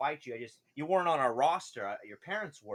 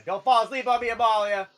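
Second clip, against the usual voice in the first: raised, loud teasing call; 7 semitones higher, 12 dB louder than usual. Acted. GM urging a player to stay awake, loud call.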